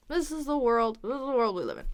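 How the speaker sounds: fast, slurred-together words; snap right through it. Shown as a demonstration. Teacher shows snapping right through, fast and connected. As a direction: rattle through it without gaps. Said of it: "you can snap right through it".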